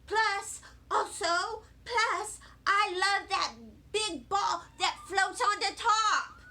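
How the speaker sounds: put-on excited little kid piling on reasons. A narrator's reading. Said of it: "I said, very excited".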